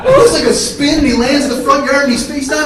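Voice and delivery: normal talking voice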